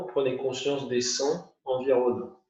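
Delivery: normal talking voice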